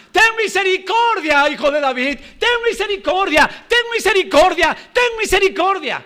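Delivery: high, repeated pleading cry for mercy; constant desperate cry. Retold or imitated. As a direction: cry out over and over like the blind men. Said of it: "Constantemente gritaban".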